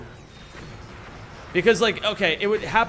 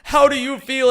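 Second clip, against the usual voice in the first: higher, much louder hyped shout; excited host shout; 7 semitones higher, 7 dB louder than usual. Retold or imitated. Terry Crews congratulates the winner on stage, higher louder excited shouting. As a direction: hype him up like a host on stage. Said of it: "he's like".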